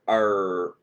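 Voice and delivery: usual speaking voice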